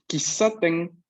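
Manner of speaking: Kisaten con una S nítida, sin sonido sh; S clara. Shown as a demonstration. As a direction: marca bien la S larga de Kisaten. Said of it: "aquí sí es S de por sí". (pronuncia Kisaten con S doble, nunca sh)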